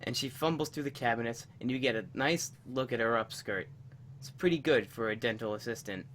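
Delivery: soft low meditation voice; guided meditation. Acted. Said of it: "usual tradition of a guided meditation".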